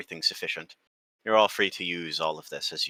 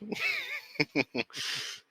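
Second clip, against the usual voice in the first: high hearty laugh; 8 dB quieter than usual. Acted. Cook laughs at sibling joke.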